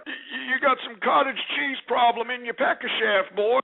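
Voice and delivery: high-pitched put-on grandpa voice; Grandpa Paul. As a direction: play a creepy old man accosting a caller. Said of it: "Oh, you want Grandpa Paul?".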